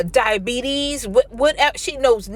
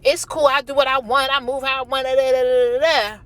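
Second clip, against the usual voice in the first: sassy defiant; 5 semitones higher, faster than usual. Retold or imitated. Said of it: "will argue probably".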